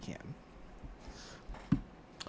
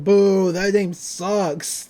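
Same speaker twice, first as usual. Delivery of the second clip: booing crowd; 5 dB louder, much slower than usual. Retold or imitated. Manitaurs booing Dipper.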